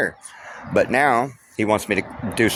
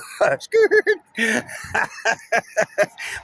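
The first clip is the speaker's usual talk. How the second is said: a drawn-out higher laugh; can't stop laughing at the situation; long laugh; 13 semitones higher than usual. Real, not acted. Extended laugh about being left.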